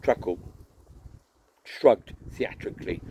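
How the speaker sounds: ordinary delivery, nothing marked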